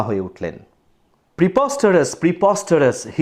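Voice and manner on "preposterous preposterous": mildly indignant narrated outburst; a touch of outrage, barely raised; angry shout. A narrator's reading. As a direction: read the shout with restrained anger. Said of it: "he shouted, hoarse with anger".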